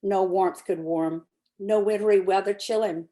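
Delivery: ordinary delivery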